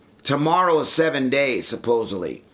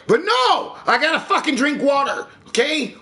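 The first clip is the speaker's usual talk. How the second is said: angry rant; 9 semitones higher than usual. Real, not acted. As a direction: blow up about having to drink water. Ranting about being denied beer.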